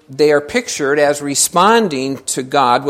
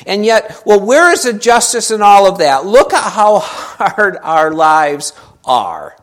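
loud, raised-pitch protest; indignant complaint; 6 semitones higher, 8 dB louder than usual. Retold or imitated. Israelites complaining God is unfair, loud and indignant. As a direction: imitate people griping at God. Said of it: "They didn't think God was really being fair".

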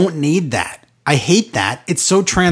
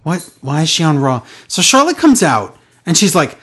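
usual speaking voice

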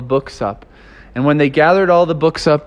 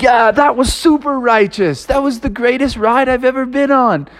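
higher, thrilled cheering; stoked friends; 8 semitones higher than usual. Retold or imitated. Friends rave about the tidal wave ride, high and stoked.

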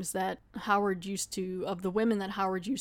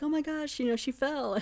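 mock scared; 6 semitones higher than usual. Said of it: "still acting scared the whole time".

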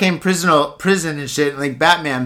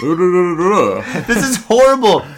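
laughing; 3 dB louder, much slower than usual. Real, not acted. Laughing at the story.

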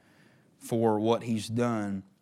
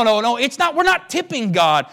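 full-force preaching; 11 semitones higher, 11 dB louder than usual. Acted. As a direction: drive each phrase home like a revival preacher.

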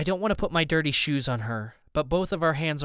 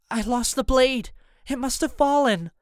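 put-on high-pitched, alarmed girl's voice; panicked; 9 semitones higher, 3 dB louder than usual. A narrator's reading. Amy discovers she lost the blade, alarmed. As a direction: pitch it up in dismay.

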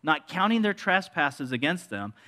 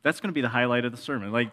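usual speaking voice

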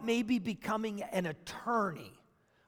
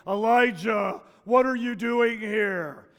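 mock booming voice of God, a bit louder; 5 dB louder than usual. God calling out to Elijah, mock booming. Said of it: "in this booming God voice".

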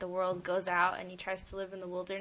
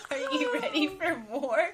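higher, laughing mock repeat of the question; teasing innuendo; 9 semitones higher, 4 dB louder than usual. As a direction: tease it like a suggestive line. Mocking repeat of host's question.

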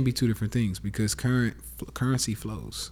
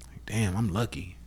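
hushed private whisper; whispering to yourself how lucky you are; 4 dB quieter than usual. Retold or imitated. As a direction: breathe it like a secret thought. Inner thought voiced as a secret.

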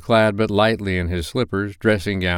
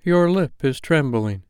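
higher, solemn ghostly warning; ghost of Christmas past; 7 semitones higher than usual. Acted. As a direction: speak as an otherworldly spirit giving a warning. Ghost notices Scrooge's emotion.

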